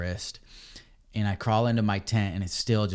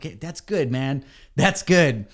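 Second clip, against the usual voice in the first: satisfied rider; 5 semitones higher, 5 dB louder, slower than usual. Retold or imitated. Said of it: "and you're like".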